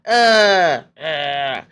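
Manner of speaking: loud drawn-out mock groan of disgust. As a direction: groan loudly in revulsion, then ease off. Groans at mirror in retelling.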